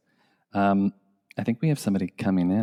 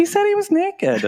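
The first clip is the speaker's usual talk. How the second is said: squeaky, much higher voice; high squeak. Real, not acted. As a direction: squeal it in mock shock. Teasing, mock-scandalized.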